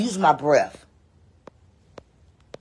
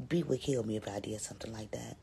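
secretive whisper aside; conspiratorial whisper; 5 semitones lower, 12 dB quieter than usual. Real, not acted. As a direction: share it like a secret, no voice. Confiding aside.

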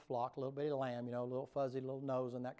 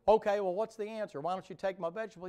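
high, loud, fast and impatient; Cain pressing; 6 semitones higher, 8 dB louder than usual. Acted. Cain demanding an answer from the Lord, high and fast. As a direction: rush the demand, pitched up. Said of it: "He said".

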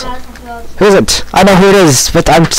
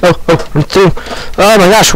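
ordinary delivery

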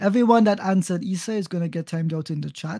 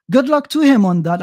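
sarcastic jab; 5 semitones higher, 10 dB louder than usual. Real, not acted.

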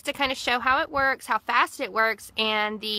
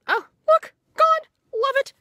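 much higher and louder, thrilled; delighted; 8 dB louder than usual. Real, not acted. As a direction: squeal with delight at the result. Delighted at instant results.

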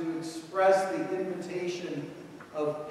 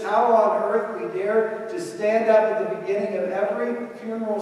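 louder, rising preaching push; impassioned preaching; 4 semitones higher, 7 dB louder than usual. Acted. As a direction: preach it with full force, building. Preaching builds in intensity.

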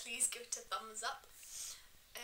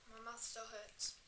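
ordinary talk, nothing marked

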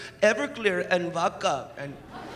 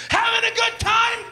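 designated driver impression; 3 semitones higher than usual. Designated driver hyping drinks at the party, then yelling at his wife. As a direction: pump up the crowd, then turn nasty. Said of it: "my impersonation of every designated driver".

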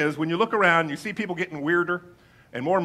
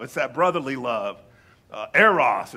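ordinary delivery

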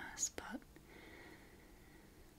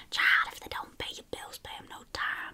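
louder, put-on South Carolina accent; hammed-up American Southern accent, breaking out louder; South Carolina old lady; 10 dB louder than usual. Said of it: "a South Carolina old lady".

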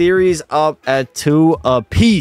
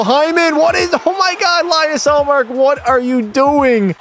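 shocked outburst; 9 semitones higher than usual. Real, not acted. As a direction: pitch jumps way up in disbelief. Player gasps at goalie blunder.

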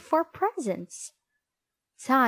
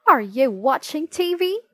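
shouting; 6 semitones higher, 7 dB louder than usual. A narrator's reading. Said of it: "shouted Mom from the kitchen".

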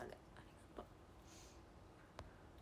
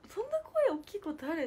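amazed; 10 semitones higher, 7 dB louder than usual. Retold or imitated. Asking who is so loud.

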